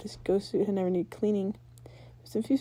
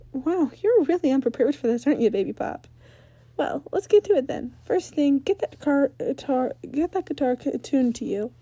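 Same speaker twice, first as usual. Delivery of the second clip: put-on higher, louder teasing rocker girl; teasing Ember; 6 semitones higher, 5 dB louder than usual. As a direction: tease him, then get down to business. Ember teases Danny.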